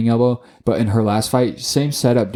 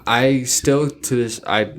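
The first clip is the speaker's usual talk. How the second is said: usual speaking voice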